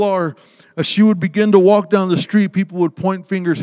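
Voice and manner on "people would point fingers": ordinary delivery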